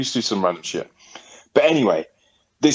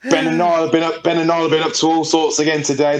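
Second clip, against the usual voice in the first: a hint of a whiny neighbour, higher; tattling neighbour; 6 semitones higher than usual. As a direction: tell on the boys to their mum. Neighbour telling on the boys.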